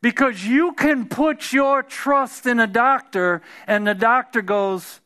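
usual speaking voice

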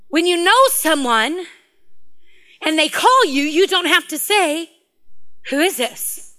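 slow, weighty sermon delivery; emphatic, measured pulpit teaching; preaching. Real, not acted. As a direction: preach it phrase by phrase with deliberate pauses. Hammering the sermon point.

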